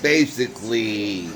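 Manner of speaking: usual speaking voice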